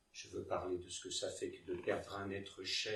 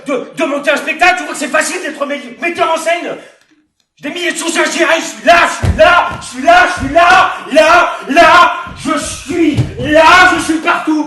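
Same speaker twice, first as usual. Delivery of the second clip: high, full-volume shouting rant; yelling, worked-up outburst; furious tirade; 8 semitones higher, 17 dB louder than usual. Acted. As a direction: rage at the whole cast. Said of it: "J'aime pas m'énerver".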